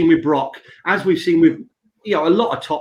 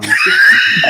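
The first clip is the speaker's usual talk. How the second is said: shouted through a burst of laughter; laughing shout; 7 dB louder, slower than usual. Real, not acted. Laughing shout telling cohost to keep going. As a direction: yell it while cracking up.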